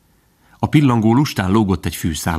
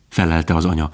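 ordinary delivery

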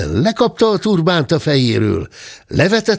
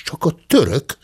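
usual speaking voice

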